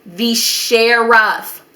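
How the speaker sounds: slow, stretched-out stunned repeat; shocked disbelief. Retold or imitated. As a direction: spell out the shock syllable by syllable.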